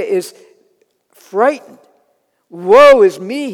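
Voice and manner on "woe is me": slow, loud cry of woe; Isaiah's mock wail of woe; cried out. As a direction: cry it out in dread. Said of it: "he cries out".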